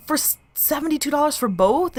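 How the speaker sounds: high, incredulous question; can't believe the bargain; retold disbelief. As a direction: pitch way up in disbelief. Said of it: "I was like".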